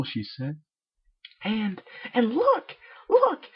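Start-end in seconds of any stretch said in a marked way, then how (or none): 1.4-3.5 rising to a loud high squeal; excited look. Acted. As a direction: build up to a shrieking look. Pinkie excitedly pointing.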